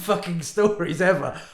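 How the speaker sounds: ordinary talk, nothing marked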